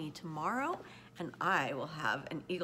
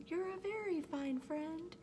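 high, thin-sized little creature voice; grateful little voice, high and careful; tiny speck voice; 11 semitones higher than usual. A narrator's reading. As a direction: speak as someone very small, pitched high and measured. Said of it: "The voice was so faint".

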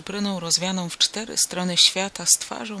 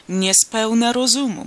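raised, higher-pitched crowd grumble; rather loud grumbling; 4 semitones higher, 7 dB louder than usual. A narrator's reading. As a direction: grumble it loudly for others to hear. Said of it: "mruczeli dość głośno ci". (dość głośne pomrukiwanie)

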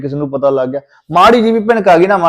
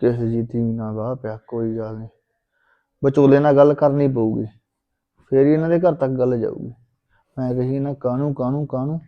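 softer, lower grumbling aside; muttered grumble; 5 semitones lower, 8 dB quieter than usual. Acted. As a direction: grumble under your breath. Husband grumbling to himself.

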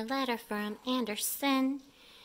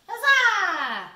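triumphant cheer; 8 semitones higher, 10 dB louder than usual. Real, not acted. Cake success.

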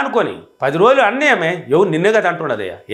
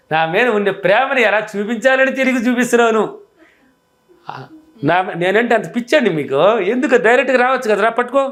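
high, sweet-talking wife teasing her husband; coaxing wife; 6 semitones higher than usual. Acted. Wife teasing her grumpy husband, high playful coaxing. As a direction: coax him playfully, much higher than your own voice. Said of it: "వచ్చి ఎదురుగా నిలబడుకో".

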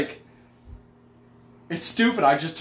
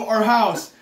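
ordinary talk, nothing marked

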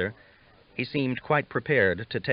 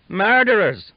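a full, much higher, much louder scream of accusation; yelled; 12 semitones higher, 11 dB louder, slower than usual. A narrator's reading. Young man at the fire accusing the captain's men, panicked yell. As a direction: yell it out in panic as you bolt. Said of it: "the young one yelled".

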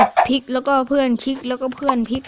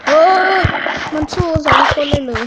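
much higher, louder surprised cry; alarmed shout; 5 semitones higher, 7 dB louder than usual. Real, not acted. Attacked by two enemies.

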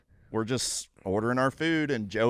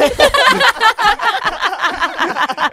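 loud high cackling laugh; a long howl of laughter; big laugh; 12 dB louder than usual. Real, not acted. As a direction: burst out laughing. Long laugh after the punchline.

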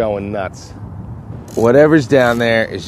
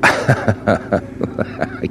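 nervous laugh; 6 dB quieter than usual. Real, not acted. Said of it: "my nerves are shot".

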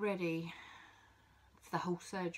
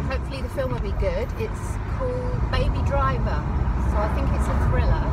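loud over road noise; 3 semitones higher, 13 dB louder than usual. Real, not acted. Update recorded in noisy car.

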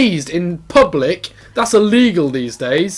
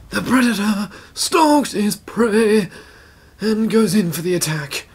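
hushed, higher documentary voice; nature documentary narrator; 5 semitones higher than usual. Pretend. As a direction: narrate the hunt like a wildlife film. Mock nature documentary narration of game.

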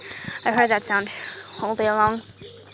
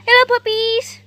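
high puppy greeting; 8 semitones higher, 6 dB louder than usual. Real, not acted. Greets the puppies a second time.

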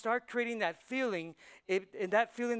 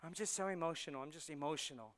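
soft, low, mock self-pity; hushed, low imitation of someone overcome; mock emotional; 4 semitones lower, 9 dB quieter, faster than usual. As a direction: imitate a feeble complaint under your voice. Mimics people who say they're emotional.

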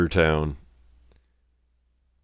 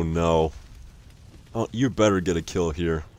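ordinary talk, nothing marked

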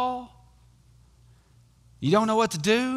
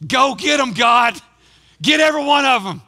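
loud, high shout to God; mock aggressive prayer; 7 semitones higher, 8 dB louder than usual. Preacher joking about children taught to pray for wrath, mock shouted prayer. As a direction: holler it like a hammed-up war cry. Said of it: "Baptists don't pray like that".

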